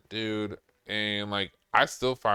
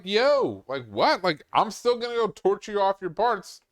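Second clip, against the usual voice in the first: higher, louder retold protest; incredulous protest; 8 semitones higher, 4 dB louder than usual. Truck shop worker to a snubbing customer, incredulous. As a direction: push back in mock disbelief.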